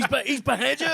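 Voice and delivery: high, loud panto "behind you" cry; pantomime shout. Retold or imitated. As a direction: yell it like a panto crowd warning the hero. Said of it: "It was like pantomime".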